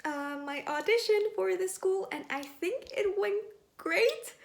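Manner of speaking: bright, loud and eager; bubbling over after the audition; excited. Real, not acted. Excited post-audition update.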